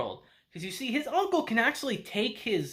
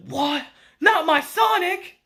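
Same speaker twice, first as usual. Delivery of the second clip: dismayed disbelief; 9 semitones higher, 6 dB louder, slower than usual. Retold or imitated. Uncle shocked about Sega.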